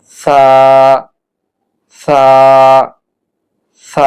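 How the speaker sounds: "সা" as a soft th, tongue at upper tooth tips; held "সা" with a very soft Arabic th; very soft consonant. Shown as a demonstration. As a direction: lisp "সা" gently off the edge of the upper teeth. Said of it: "অত্যন্ত নরম করে উচ্চারণ করতে হবে".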